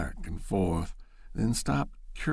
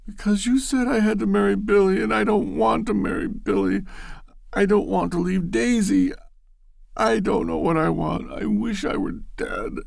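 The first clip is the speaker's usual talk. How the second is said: high, loud, fast pleading wail; tearful outburst; 13 semitones higher, 4 dB louder than usual. Acted. Loretta wails at Bashford, high and loud. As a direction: blurt it all out in tears.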